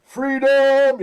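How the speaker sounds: high, loud yelled freedom; Braveheart battle cry. Retold or imitated. Co-host quoting Braveheart's big battle cry, shouting.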